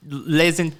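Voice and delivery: halting attempt at French; French phrase. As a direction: fumble it in a light French accent. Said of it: "You're what the French call".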